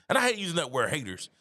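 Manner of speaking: usual speaking voice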